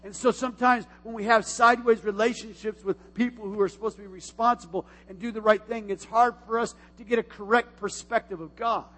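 usual speaking voice

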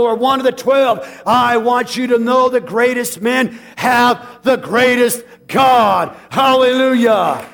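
loud, rising preacher's cry; shouting sermon climax building to hallelujah; full-force preaching. Acted. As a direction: preach it out to the rafters.

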